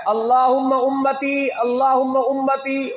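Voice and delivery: high, drawn-out plea in Arabic; slow imploring recitation; imploring prayer. Retold or imitated. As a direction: call it out slowly, stretching each phrase high. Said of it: "اور کیا فرمایا".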